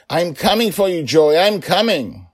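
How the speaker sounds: high loud shout; determined rescuing shout; calling back. Acted. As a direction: yell back to reassure her. Father flies toward her voice.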